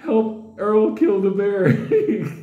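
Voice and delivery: high, slow, laughing plea; scared prayer, slow and high; mock pleading prayer. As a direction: beg Jesus like a scared child. Praying inside tent.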